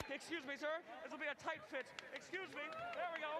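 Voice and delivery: softly asking to pass; very quiet polite murmur. Real, not acted. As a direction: ease past, barely audible. Squeezing past, same words again.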